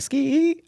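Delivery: high-pitched mock squeal; imitated hollering. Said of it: "she was hooting and hollering".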